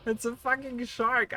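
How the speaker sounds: high, slow squeal of delight. Real, not acted. Streamer showing off new shark hat, squealing.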